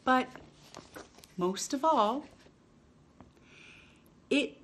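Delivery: lively picture-book read-aloud with long pauses; reading to children. Acted. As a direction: read it like story time for little ones.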